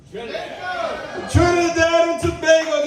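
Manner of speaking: Trinidadian lilt, called out with pride; Trinidadian accent. Shown as a demonstration. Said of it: "I'll talk in a little accent".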